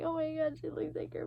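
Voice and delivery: high, very soft mock whimper; imitated dream crying. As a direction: act out crying, small and high. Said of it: "crying in my dream".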